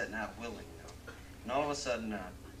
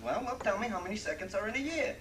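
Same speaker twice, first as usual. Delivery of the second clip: much higher, louder, challenging; challenging New Yorker; 11 semitones higher, 7 dB louder than usual. Acted. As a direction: dare him to answer.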